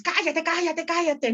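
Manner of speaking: cállate repeated high and insistent; repeated shushing. Retold or imitated. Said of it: "que dijo, el Luis". (repetir cállate, agudo e insistente)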